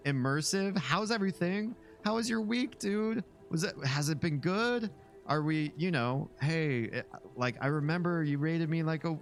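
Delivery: mock parasocial streamer greeting; overfriendly Twitch streamer. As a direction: gush over the viewer like a streamer hungry for friends. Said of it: "and they talk like this".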